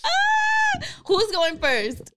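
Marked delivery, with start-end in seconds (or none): 0.1-0.9 high-pitched delighted shriek; excited squeal. Real, not acted. Host hears groom has bars, excited squeal.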